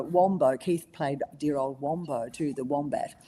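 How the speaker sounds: normal talking voice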